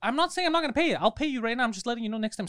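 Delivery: mildly heated retold protest; retold frustration. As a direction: push back in mock exasperation. Retold frustrated argument with clerk.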